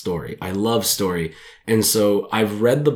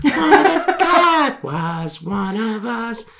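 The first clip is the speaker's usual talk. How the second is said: slow, high falsetto singing, laughing through it; hammy falsetto croon, cracking up; girl voice; 13 semitones higher, much slower than usual. Acted. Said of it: "That's my girl voice".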